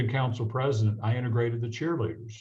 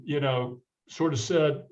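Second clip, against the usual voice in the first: usual speaking voice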